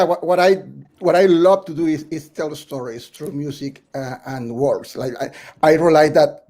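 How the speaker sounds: ordinary delivery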